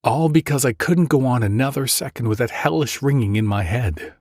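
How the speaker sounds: plain reading voice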